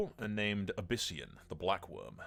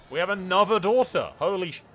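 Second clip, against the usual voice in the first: loud, high exclamation of disbelief; real high-pitched shock; astonished outburst; 9 semitones higher, 9 dB louder than usual. Real, not acted. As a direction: blurt it out in loud amazement. Startled by another daughter being born.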